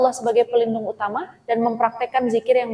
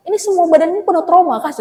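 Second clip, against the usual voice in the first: exasperated retort; 8 semitones higher, 8 dB louder than usual. Retold or imitated. Speaker's exasperated retort to friend.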